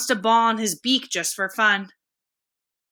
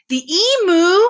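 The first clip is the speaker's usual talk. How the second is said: high, loud gasp of disbelief; astonished gasp; 8 semitones higher, 6 dB louder than usual. Acted. Edward overhearing he is the favorite, surprised gasp. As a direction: gasp it out as if you can't believe it. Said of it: "gasped Edward".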